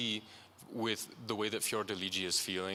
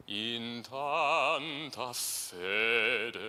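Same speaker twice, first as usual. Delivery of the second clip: "In tanta fede" with weight on the first eighth, lighter on the second; very slow, leaning into "tanta"; lean on first note; much slower than usual. Shown as a demonstration. Coach showing a Mozartian lean to the singer. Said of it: "lean on those first eighth note".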